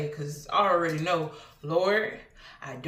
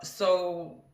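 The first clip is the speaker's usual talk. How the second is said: ordinary talk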